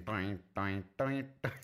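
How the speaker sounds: sing-song toing of one string; plinking string noise. Retold or imitated. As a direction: mimic a single plucked string. Imitating one guitar string.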